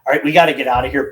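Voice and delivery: ordinary delivery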